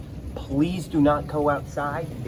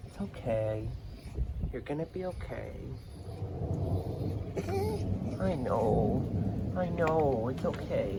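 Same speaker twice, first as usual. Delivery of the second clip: soothing dog; 7 dB quieter than usual. Real, not acted. Soothing the scared dog quietly.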